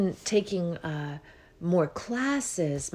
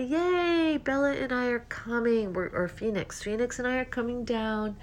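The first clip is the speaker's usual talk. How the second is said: excited; 6 semitones higher than usual. Retold or imitated. Retells her excited call to dad.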